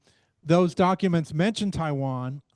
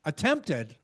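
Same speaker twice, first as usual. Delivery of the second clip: pause, then stress "attempted" by pitch rather than volume; 3 semitones higher, 4 dB quieter than usual. Real, not acted. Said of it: "maybe emphasis on the word attempted".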